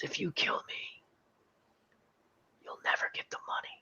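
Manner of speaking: parched, breathless whisper; imitating a dying whisper. Sunburned Blondie threatens Tuco from the desert floor, whispered. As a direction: rasp it out like a man dying of thirst.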